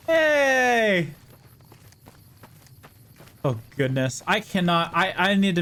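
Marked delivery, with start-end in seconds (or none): 0.1-1.1 surprised shout. Real, not acted. Sudden exclamation.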